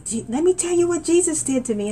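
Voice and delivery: high, eager telling; young believer witnessing on the bus. Retold or imitated. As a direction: lean in bursting to share. Preaching to bus riders.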